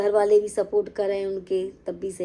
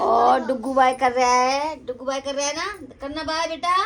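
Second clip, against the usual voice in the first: higher, sing-song coaxing; 6 semitones higher, 6 dB louder than usual. Real, not acted. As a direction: coax the little one to wave bye. Coaxing child to wave bye.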